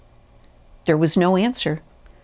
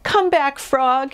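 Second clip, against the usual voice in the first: high, pleading call; high, drawn cry of desperation; storybook shout; 8 semitones higher, slower than usual. A narrator's reading. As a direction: call a friend back in a raised pitch. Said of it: "he shouted".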